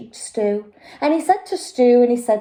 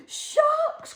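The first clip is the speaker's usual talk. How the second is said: high startled cry of alarm; frightened cry; 3 dB quieter than usual. Acted. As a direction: cry out at the sharks. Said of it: "cried Rose".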